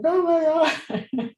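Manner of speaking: drawn-out, high overwhelmed gasp of words; overwhelmed oh my God. Retold or imitated. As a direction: stretch it out, overcome with emotion. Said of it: "I'm always like".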